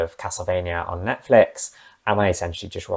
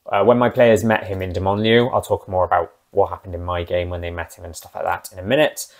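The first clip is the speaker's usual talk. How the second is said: ordinary talk, nothing marked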